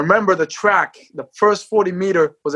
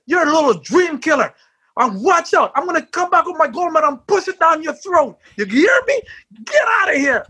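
high, yelled outburst at the reporter; furious shout; 12 semitones higher than usual. Retold or imitated. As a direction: blow up at him, voice cracking high. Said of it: "I went off".

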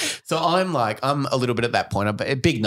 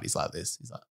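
whisper; 6 semitones lower, 14 dB quieter than usual. Retold or imitated. Showing the dog's face.

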